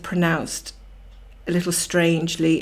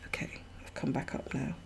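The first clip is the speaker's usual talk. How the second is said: whispered aside; 4 semitones lower, 16 dB quieter than usual. Real, not acted. Joking aside after rabbit-hole slip.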